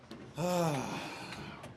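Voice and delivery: long, weary exhale; heavy sigh. Real, not acted. Streamer reacts to the media being sent out, heavy sigh.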